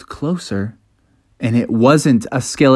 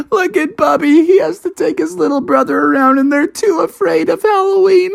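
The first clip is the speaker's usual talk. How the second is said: high-pitched playground teasing; mocking kids. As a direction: jeer at the scaredy brothers. Kids tease Bubby.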